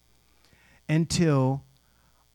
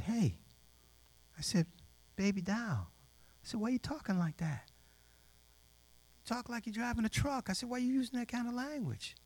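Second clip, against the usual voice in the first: very soft, high, tender questioning; hushed, delicate chiding; gentle fatherly reproach; 11 semitones higher, 12 dB quieter than usual. Retold or imitated. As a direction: coax her softly like a concerned father. Fatherly talk to a young lady.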